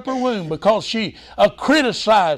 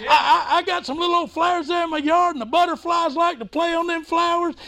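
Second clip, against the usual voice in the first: high-pitched, fervent sermon; excited preaching; 10 semitones higher than usual. Real, not acted. Preacher telling the congregation to get excited about God, fervent. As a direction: exhort them in a raised preacher's pitch.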